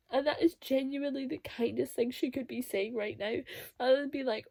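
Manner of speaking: usual speaking voice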